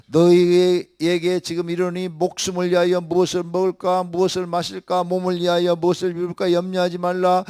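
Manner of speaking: loud, high ringing scripture reading; full-force sermon reading; preaching scripture. Acted. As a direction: proclaim the verses to the whole hall.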